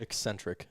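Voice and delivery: "Eccentric" said softly as "ek-SEN-trik"; quiet correction, sounding the K in "Eccentric"; two-C pronunciation. Shown as a demonstration. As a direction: sound both C's in "Eccentric". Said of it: "There's two C's".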